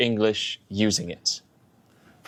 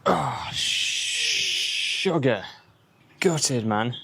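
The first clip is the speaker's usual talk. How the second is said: dismayed; 4 semitones higher, much slower than usual. Real, not acted. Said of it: "screen cracked dismay".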